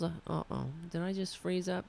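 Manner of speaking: normal talking voice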